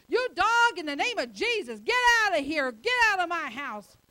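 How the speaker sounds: loud, high shout of rebuke, commanding; rebuking the devil. Retold or imitated. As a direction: cast the devil out with a yell.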